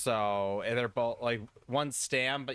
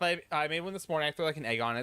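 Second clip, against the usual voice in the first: ordinary talk, nothing marked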